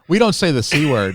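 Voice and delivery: a loud hammed-up scold from a strict mom; mock prudish parent.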